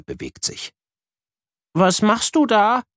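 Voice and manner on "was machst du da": put-on high child voice asking; curious little boy, higher; little brother. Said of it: "will ihr Bruder Luca wissen".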